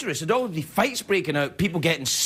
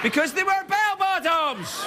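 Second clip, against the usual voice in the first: much higher shouted punchline, dragged out. Acted. Comic yelling the bell-bottom punchline to the crowd, high shout. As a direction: yell the punchline high and slow.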